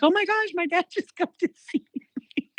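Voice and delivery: high, breathless wonder with a laugh; amazed exclamation. Retold or imitated. Waking from the dream, she tells the host her amazement. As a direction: gasp it out, thrilled and laughing.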